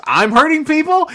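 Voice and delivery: hammed-up shocked squawk among the bodies; incredulous reply. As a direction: squeal it like the accusation is absurd. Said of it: "he looks around and he goes".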